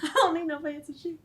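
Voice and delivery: put-on little Kayla sassing in Southern accent. Ten-year-old Kayla with mom, Southern accent. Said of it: "ten-year-old Kayla".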